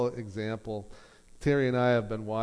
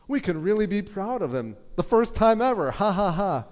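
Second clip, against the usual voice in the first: imitating the sister, higher and louder with a fake laugh at the end; mocking sister; 8 semitones higher, 6 dB louder than usual. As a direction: ham up the sister's backhanded praise, then cackle.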